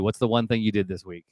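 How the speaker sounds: ordinary delivery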